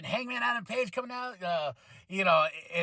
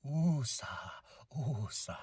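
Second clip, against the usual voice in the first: calming breath; 7 semitones lower, 8 dB quieter, slower than usual. Real, not acted. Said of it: "I'm getting overheated".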